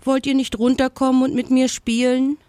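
high, quick pleading invitation; calling out. A narrator's reading. Leo invites the monkeys down to play, calling out. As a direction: call up an eager question. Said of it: "rief er noch einmal".

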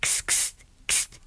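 soft unvoiced kst kst; quiet hissing noise in the dark; whispered kst. A narrator's reading. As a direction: hiss the tiny kst sounds. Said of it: "machte es".

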